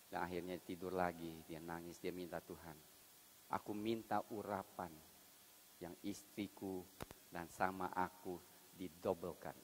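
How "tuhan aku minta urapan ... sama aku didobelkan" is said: much softer, hushed plea; soft tearful prayer. Acted. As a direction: pray quietly as a grieving husband. Smith weeping, praying to God.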